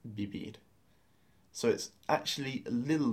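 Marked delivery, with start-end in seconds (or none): 0.1-0.5 Vivir said softly as "bibir". Shown as a demonstration. Teacher echoing the student's attempt, V like B. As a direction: give Vivir a B in place of each V. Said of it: "pronounce it more like a B".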